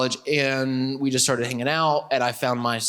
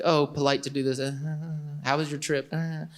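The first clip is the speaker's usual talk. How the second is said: quiet, stilted small talk; awkward small talk; 7 dB quieter than usual. Retold or imitated. Student to new acquaintance at first meetings, awkward. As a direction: trail off into awkward little noises. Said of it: "it was kind of like this au-, awkward".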